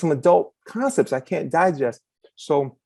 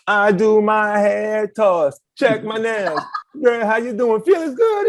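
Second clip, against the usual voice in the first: slow, very high, loud and sassy; hammed-up girl voice calling out feeling good; sassy daughter; 9 semitones higher, 4 dB louder, slower than usual. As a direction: mimic her proud hair toss, laughing. She was like.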